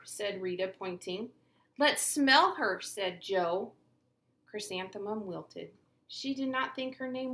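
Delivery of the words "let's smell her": slow, loud mocking jeer; teasing drawl. Joe mocking Chrysanthemum, loud and slow. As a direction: drag out the taunt.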